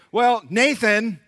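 put-on slow drawn-out kid blaming; kid blaming brother. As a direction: drag it out like a kid passing the blame. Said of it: "He would say".

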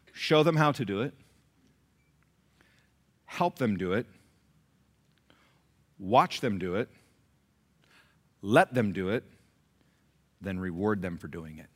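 phrase by phrase, long pauses between; slow dictation. Shown as a demonstration. Preacher giving parents the method to write down, slowly. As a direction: say it for people writing it down. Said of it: "I'm gonna say it slow".

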